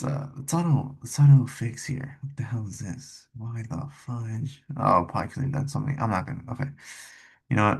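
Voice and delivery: ordinary delivery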